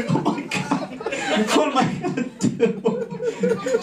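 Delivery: whispered then high and slow; mock panic. Panicking at the pay toilet door, mock-desperate. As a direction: play the panicked traveller.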